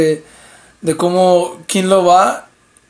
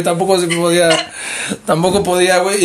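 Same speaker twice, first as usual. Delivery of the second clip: ordinary talk, nothing marked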